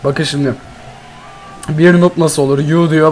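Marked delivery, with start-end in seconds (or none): none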